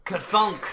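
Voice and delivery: loud mace-impact sound effect; heavy clunk. Acted. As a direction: punch out a crunching thud. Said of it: "more like a crunching".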